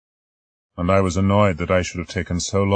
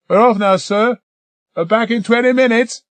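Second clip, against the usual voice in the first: high, louder calling voice; crewman calling; 8 dB louder than usual. Acted. Jost calling to the doctor as they leave. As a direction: call back from the door.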